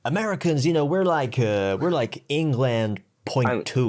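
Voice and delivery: hammed-up American accent; shit American accent. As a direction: do a bad American. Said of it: "my shit American accent".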